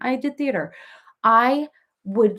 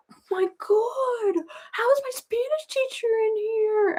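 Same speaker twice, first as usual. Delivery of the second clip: very high, strained mock panic; imitating a teen's high-pitched horror; mortified son; 13 semitones higher, slower than usual. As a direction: squeal it under your breath, drawn out. Retold son panicking at seeing teacher.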